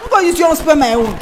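loud high defiant yell; mock shout. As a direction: shout it out, half joking. Mock stubborn wife shouting.

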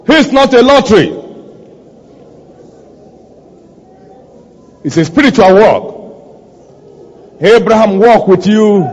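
emphatic, projected prayer leading; fervent preaching. Real, not acted. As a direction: preach it out with weight, pausing between phrases.

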